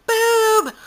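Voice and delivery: high, loud comic yell; playful one-word holler; mock scream. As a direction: blurt it out as a high-pitched shout. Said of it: "look at each other and scream".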